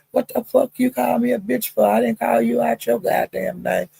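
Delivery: high-pitched retold outrage; indignant retort. Man objecting to being called a name, high mock indignation. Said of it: "and he said".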